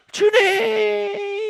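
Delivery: long high loud wail of Lord; drawn-out desperate cry. Retold or imitated. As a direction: stretch the cry to heaven. Students crying out in prayer.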